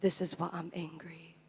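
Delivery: low, near-silent whisper; whispered refrain. Acted. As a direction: breathe the line out in a whisper.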